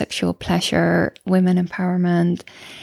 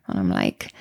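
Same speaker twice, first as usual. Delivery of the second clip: usual speaking voice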